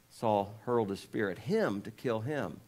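ordinary delivery

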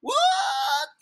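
very high squealed disbelief; shocked disbelief. Pretend. Reader appalled by the song, shocked squeal. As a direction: squeal it in mock horror.

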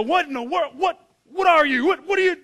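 loud, high, stammering mock panic; startled freak-out. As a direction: blurt it out as if facing an intruder. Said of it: "imagine if that was you".

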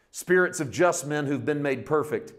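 usual speaking voice

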